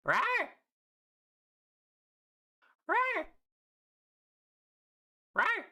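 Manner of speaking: high squeaky dinosaur roar. Pretend. Playful roars after break announcement.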